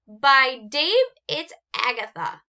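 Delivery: blocked-nose monster voice; stuffed nose. Acted. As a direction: talk through a stuffed nose. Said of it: "through stuffed nose".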